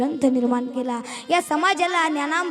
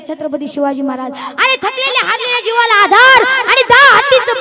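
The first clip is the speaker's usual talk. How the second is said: fiery preaching; 5 semitones higher, 11 dB louder than usual. Acted. Preacher rousing the kirtan audience, fiery loud praise. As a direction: thunder the praise to the crowd.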